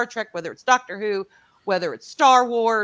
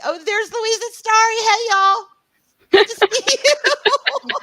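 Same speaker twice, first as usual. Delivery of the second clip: high, loud gleeful hello; delighted squeal; 11 semitones higher, 8 dB louder than usual. Real, not acted. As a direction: squeal at friends. Spots friends in chat.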